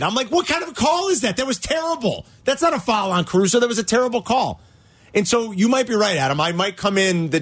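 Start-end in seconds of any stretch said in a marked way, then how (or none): 0.3-4.6 retold outrage. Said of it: "I was livid".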